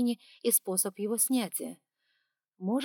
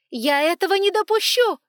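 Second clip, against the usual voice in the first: high, loud shout after him; 12 semitones higher, 13 dB louder than usual. A narrator's reading. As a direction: yell it at his back. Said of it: "крикнула ему вслед".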